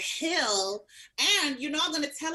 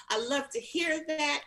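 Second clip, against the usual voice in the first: usual speaking voice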